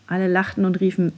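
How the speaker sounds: usual speaking voice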